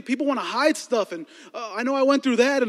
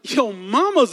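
mock outraged retort, higher and louder; mock comeback; 5 semitones higher, 6 dB louder, much slower than usual. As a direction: start a yo-mama insult, hammed-up. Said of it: "conversation happened in my head".

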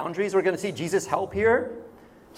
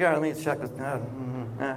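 hesitant reply; 6 semitones lower, 7 dB quieter than usual. Acted. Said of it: "He's like".

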